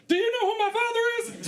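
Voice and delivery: high, fast, laughing yell; entitled yell. Retold or imitated. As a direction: demand it like a spoiled kid at the cops. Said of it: "got to yell".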